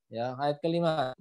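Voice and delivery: ordinary talk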